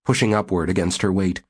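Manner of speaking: ordinary delivery, nothing marked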